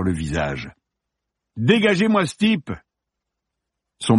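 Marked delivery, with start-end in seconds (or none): 1.6-2.7 high, exasperated call to the crew; angry order. Acted. Film star to his crew about the intruder, angry order. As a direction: demand his removal, voice pitched way up. Actor demands cop be removed.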